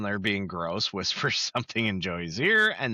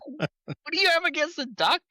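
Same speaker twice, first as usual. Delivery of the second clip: high protest; 14 semitones higher than usual. Retold or imitated. Joey defending the duck.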